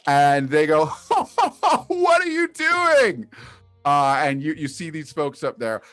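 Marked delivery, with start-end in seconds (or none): 0.8-3.3 high, loud, drawn-out taunt through laughter; cackling onlooker. Acted. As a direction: cackle and jeer from up top. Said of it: "and they're cackling".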